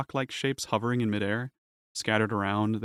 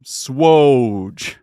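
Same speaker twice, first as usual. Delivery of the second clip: booming alien voice; 6 semitones higher, 11 dB louder, much slower than usual. Acted.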